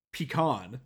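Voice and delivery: "pecan" as pee-KAHN; "pecan" blending both ways, pee-KAHN; mixed-up pronunciation. Shown as a demonstration. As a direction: say "pecan" with long ee, then open ah. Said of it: "I just mix up the".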